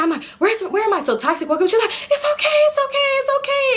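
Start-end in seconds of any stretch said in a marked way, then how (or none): 0.4-1.0 high, panicked stammered question; reenacted alarm, pitched way up; mock scream. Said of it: "So I'm screaming". 1.9-3.8 very high-pitched, rapid soothing; squeaky-high repeated reassurance; urgent reassurance. Retold or imitated. Friend hurriedly reassures her on waking.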